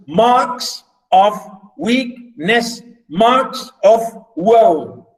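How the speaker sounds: very slow, each syllable a separate beat; syllable-by-syllable reading. Shown as a demonstration. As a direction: scan the verse with gaps between beats. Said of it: "one syllable".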